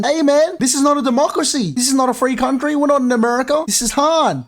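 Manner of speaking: high, fast, insistent scolding; hammed-up soldier laying down the law; bossy soldier. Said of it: "telling them".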